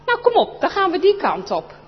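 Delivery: high loud push onward; eager boy. Acted. Chris to Anneke, high loud eager urging.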